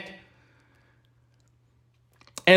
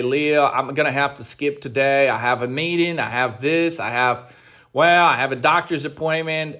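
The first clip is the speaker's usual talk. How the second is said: lower, mumbling excuses like a lazy student; lightly voiced whiny trader making excuses; mock excuse-making trader; 6 semitones lower than usual. Coach mocks traders who skip days, lower mumbled excuses. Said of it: "we're like".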